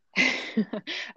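short high burst of laughter; bright laugh. Real, not acted. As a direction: laugh out loud at the joke. Laughs at co-host's remark.

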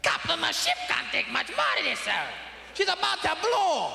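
high, tense engineer shouting warnings; hammed-up Scotty warning the captain; panicked Scotty. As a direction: plead with the captain like a frantic engineer. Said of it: "Mister Scott say".

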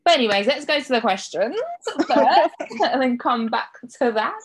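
laughing through the words. Real, not acted. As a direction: giggle as you change subject. Laughing while moving on.

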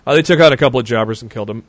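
fast, offhand report; matter-of-fact, rattled off quickly; casual. Real, not acted. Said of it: "He said it so casually".

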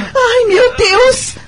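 panicked high cry of alarm; alarm shout. Acted. Wife sees husband collapse. (grito de alarme aflito)

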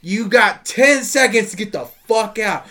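retelling a movie husband's threat in a hammed-up voice; mock threatening husband. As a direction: bark out a movie-style ultimatum.

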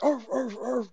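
high yapping dog barks; yappy arf arf. Retold or imitated. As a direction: imitate the neighbour's dogs barking. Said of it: "I'm hearing the dogs outside".

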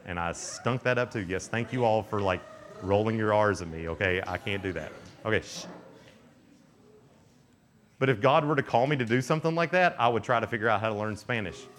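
normal talking voice

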